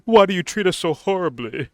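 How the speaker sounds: mock-whine like a wounded child; whiny orc. Vlogger mocking the orc pleading with Sauron, high whine. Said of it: "He was like".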